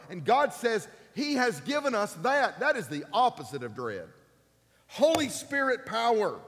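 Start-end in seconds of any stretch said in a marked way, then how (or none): 4.9-6.4 loud and high, stress on Holy; preaching. Acted. Pastor calling out to church, loud. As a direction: ring out HOLY Spirit power.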